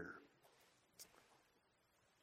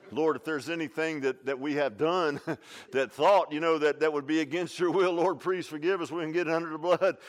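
louder, earnest pleading prayer; mock prayer; 4 semitones higher, 9 dB louder than usual. Couple praying in the car before church, mock earnest prayer. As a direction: pray it like a nervous churchgoer. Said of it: "have a prayer meeting in the car".

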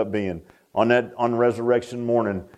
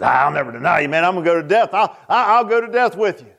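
higher, excited mock bravado; boastful Peter; 5 semitones higher, 4 dB louder than usual. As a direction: act out Peter's cocky vow, building in pitch. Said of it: "he was afraid to boast".

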